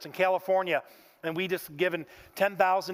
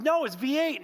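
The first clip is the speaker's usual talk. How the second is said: shocked mom; 8 semitones higher than usual. Retold or imitated. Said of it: "she had a heart attack on the phone".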